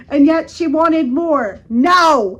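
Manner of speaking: getting worked up and yelling No at the book; excited outcry. Real, not acted. Reader yelling at the book's kiss scene, excited. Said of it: "reacts to the kiss scene".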